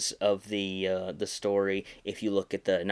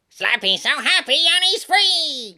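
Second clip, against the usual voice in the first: much higher, louder goofy puppet voice; silly sing-song dummy; 10 dB louder than usual. Shown as a demonstration. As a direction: play it like a cartoon toy. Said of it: "kind of voice".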